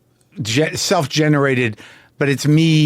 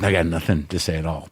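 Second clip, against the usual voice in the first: deflated inner voice; 8 semitones lower, 6 dB quieter, faster than usual. Retold or imitated. Inner voice doubting on the mic.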